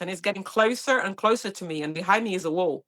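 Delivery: ordinary talk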